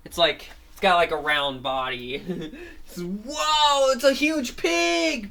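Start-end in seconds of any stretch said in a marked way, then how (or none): 3.0-5.3 high, loud and slow gasp of wonder; wide-eyed onlooker, much higher; amazed crowd. Acted. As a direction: gawk at the giant pig. Crowd gawks at pig mecha.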